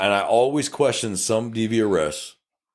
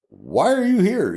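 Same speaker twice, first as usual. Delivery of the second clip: slow, high, louder disbelief; incredulous question; 10 semitones higher, 3 dB louder, slower than usual. Retold or imitated. Deputy finds the husband back at the house, slow and stunned. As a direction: drag it out like you can't believe he's there.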